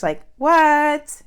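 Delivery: loud, high burst of disbelief; shocked that the stranger had a fish sandwich. Real, not acted. Telling viewers about stranger's lucky sandwich, loud high disbelief.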